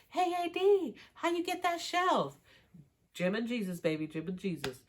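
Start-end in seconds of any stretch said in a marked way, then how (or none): 0.1-2.4 high, drawn-out mock holler; mock calling out. Chelsea calls out to A D about her figure, mock calling out. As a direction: call over teasingly in a much higher voice.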